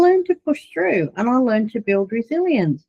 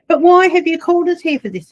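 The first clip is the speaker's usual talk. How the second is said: higher and louder, indignant challenge; man confronting her at the prayer meeting, angry; retold angry demand; 8 semitones higher, 6 dB louder than usual. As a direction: push back hard at her. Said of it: "he got so angry".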